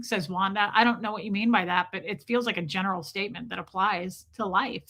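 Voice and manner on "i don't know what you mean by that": usual speaking voice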